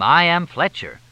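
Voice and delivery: high, loud mimicry of a pompous introduction; mock someone grandly announcing their name; mocking, self-important imitation; mock-pompous announcement. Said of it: "You announced your name".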